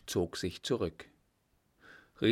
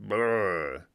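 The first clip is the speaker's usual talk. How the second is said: shuddering brrr; 4 dB louder than usual. Acted. Soldier shudders at touching dog.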